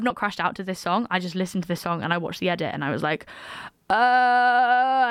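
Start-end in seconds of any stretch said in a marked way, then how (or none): 3.9-5.1 long, loud, raised "uh"; drawn-out loud uneasy "uh"; icked-out groan. Retold or imitated. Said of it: "it felt weird and it felt icky".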